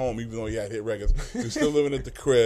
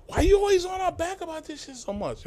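high, pitched-up imitation of a young rapper; young rapper complaining to him, pitched-up mock voice; mock young guy. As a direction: voice the kids pushing back, high and unlike your own. Young guys pushing back at him.

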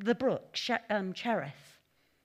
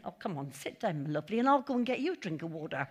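kind welcome; 6 semitones lower, faster than usual. Retold or imitated. Offering the old widow a seat and water, kindly. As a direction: coax her to sit like a fond aunt. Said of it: "I'd have been saying".

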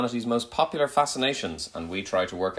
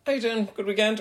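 much higher, bright greeting; cheery teacher greeting; 13 semitones higher than usual. Retold or imitated. Teacher greeting a pupil in a shop, high and cheery. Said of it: "I sort of said".

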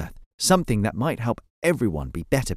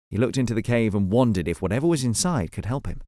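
ordinary delivery

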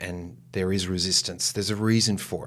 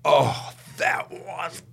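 much higher, slow gushing praise; impressed castmate; 11 semitones higher, much slower than usual. Retold or imitated. Castmate praising his pause, high and gushing. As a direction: gush like a dazzled fellow actor. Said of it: "one of the guys comes up and goes".

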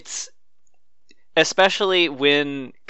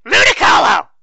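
high loud shouted name; trailer shout; 12 semitones higher, 10 dB louder than usual. Retold or imitated. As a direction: yell it like the movie trailer.

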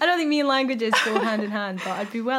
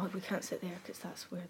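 hushed aside; 3 semitones lower, 15 dB quieter than usual. Retold or imitated. Retold poolside thought, hushed.

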